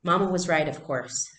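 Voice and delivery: usual speaking voice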